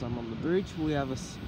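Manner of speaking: ordinary talk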